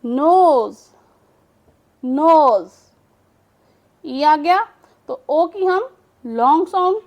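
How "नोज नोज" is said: नोज said loud and firm; word demo. Shown as a demonstration. Teacher to students, modelling नोज loudly. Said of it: "अब देखें".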